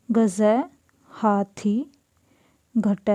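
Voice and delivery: ordinary talk, nothing marked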